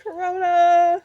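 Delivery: playful. Real, not acted. Punning on corona.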